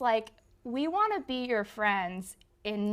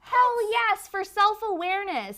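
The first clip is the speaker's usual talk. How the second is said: cheering; 9 semitones higher, 6 dB louder than usual. Real, not acted. Cheering fan's answer.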